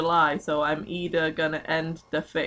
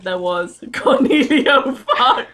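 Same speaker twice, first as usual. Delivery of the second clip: laughing; 6 semitones higher, 8 dB louder than usual. Real, not acted. Reading the fanfic name as a joke.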